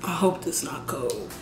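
low whispered aside. Real, not acted. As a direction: hush it, almost to yourself. Worried aside about cold food.

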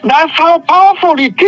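usual speaking voice

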